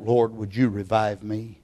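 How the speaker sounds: low, soft voiced prayer; subdued plea to God. Preacher voicing others asking for revival, quietly pleading. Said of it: "Others will cry out".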